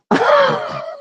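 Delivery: a high cackle breaking out; high shrieking laugh. Real, not acted. Vlogger mocking a politician, high cackling laugh. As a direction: cackle at your own jab.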